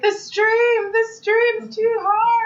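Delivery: put-on high, slow, whiny girl voice; high complaining girl. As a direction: stretch it out, high and pleading.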